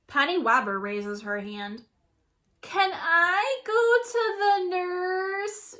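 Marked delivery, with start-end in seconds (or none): 2.6-5.8 high, slow whine; whining. A narrator's reading. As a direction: whine it out pitifully. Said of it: "she whines".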